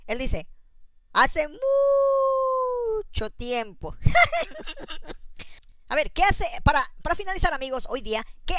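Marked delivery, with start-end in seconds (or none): 1.1-4.0 very high, very slow drawn-out moo of the words; mooing like a cow. Acted. Kid called cow, answers like one. 4.0-5.5 shrill canned-style cackle after the punchline; high cackle. Real, not acted. Host laughs at own joke.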